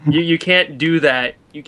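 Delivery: ordinary talk, nothing marked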